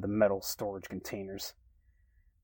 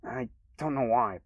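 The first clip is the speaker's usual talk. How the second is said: plain reading voice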